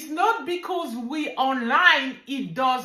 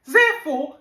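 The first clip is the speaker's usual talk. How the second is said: ordinary talk, nothing marked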